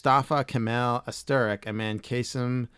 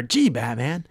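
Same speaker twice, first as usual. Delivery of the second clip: put-on higher and slow boy-sidekick voice; lightly voiced higher cartoon Robin; higher-pitched Robin; 7 semitones higher, 5 dB louder, slower than usual. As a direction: squeak it out like an eager sidekick. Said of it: "He was a little higher pitched".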